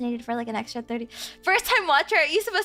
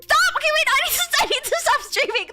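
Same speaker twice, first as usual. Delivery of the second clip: much higher and louder, squealing protests; flustered squeal; 11 semitones higher, 4 dB louder than usual. Real, not acted. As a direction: squeak it out in disbelief. Reacting to donations.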